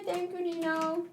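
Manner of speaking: high squeaky little voice; squeaky Nino voice. Pretend. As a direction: pipe it up tiny and high.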